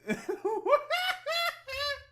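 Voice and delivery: high-pitched helpless laughter. Real, not acted. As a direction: crack up, high and loud. Cracking up at the clip.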